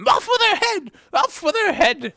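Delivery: very high, loud furious scream; screaming queen. Acted. Furious queen ordering Alice's execution, screaming. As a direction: shriek the order at full force. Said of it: "began screaming".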